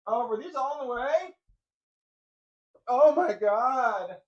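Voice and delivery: very high, then a slow loud "oh my God"; high startled coo, stretching out the last words; surprised pet talk. Real, not acted. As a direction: gasp of realization at the dog. Realizing dog was blocked.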